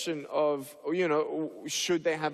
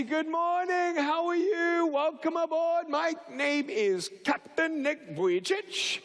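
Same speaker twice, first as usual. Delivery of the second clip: high-pitched showy pilot hello; pretend pilot greeting; 11 semitones higher than usual. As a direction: sing out a cheery captain's greeting.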